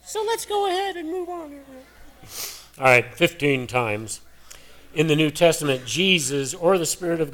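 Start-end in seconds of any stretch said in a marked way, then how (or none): 0.1-1.6 high squeaky voice from a sore throat; cracking voice. Real, not acted. Preacher's strained voice squeaking up. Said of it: "all that fresh air has really caused a problem". 2.8-4.2 manly voice. Real, not acted. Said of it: "I try to have a manly voice".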